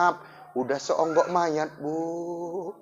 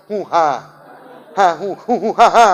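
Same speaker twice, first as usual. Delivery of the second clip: mock hooting; 8 dB louder than usual. Preacher mimics noise, rising.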